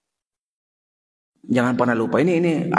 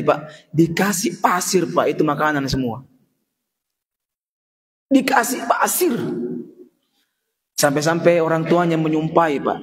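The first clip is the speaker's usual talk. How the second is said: usual speaking voice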